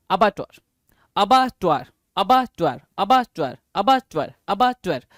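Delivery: अबाटॉयर repeated as a-ba-tar, drilled over and over; drilling pronunciation. Shown as a demonstration. Teacher drilling अबाटॉयर as a-ba-tar for students to memorise. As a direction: say अबाटॉयर again and again as a-ba-tar so it sticks. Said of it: "मैं करा देता हूं".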